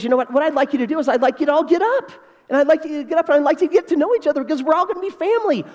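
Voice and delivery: high, quick rallying call to the room; lightly voiced uncle urging everyone up; uncle addressing guests. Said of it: "Uncle Mitch says".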